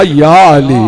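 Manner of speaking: low, strong call. Acted. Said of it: "पता नहीं किस अंदाज से कहा".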